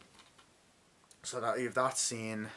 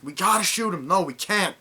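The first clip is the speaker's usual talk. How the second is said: heated argument; 9 semitones higher, 8 dB louder than usual. Retold or imitated. Retold soldiers arguing over a prisoner.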